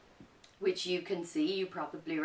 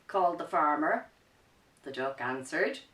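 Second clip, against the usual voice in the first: lively picture-book narration, a bit louder; reading to children; 7 dB louder than usual. A narrator's reading. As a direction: tell the story with energy for kids.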